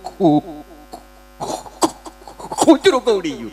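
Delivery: stuck on "કુ, કુ" before "કૂતરું"; slow stutter on the first sound; comic stutter; stammering. Retold or imitated. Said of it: "તોતડી જીભ".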